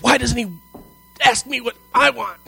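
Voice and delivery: high, loud whiny complaint; whiny spouse. Retold or imitated. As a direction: mimic a spouse who feels ignored.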